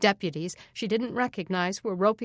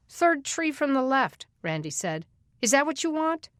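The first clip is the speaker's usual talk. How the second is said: sarcastic; 6 semitones higher than usual. Acted. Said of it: "So Randy was a comedian".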